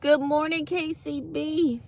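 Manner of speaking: high bright hello; cheerful greeting. Real, not acted. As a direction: sing out the greeting. Greeting the station.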